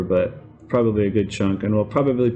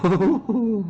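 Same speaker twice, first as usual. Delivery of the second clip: high ghostly moan; creepy ghost voice; 10 semitones higher than usual. Pretend.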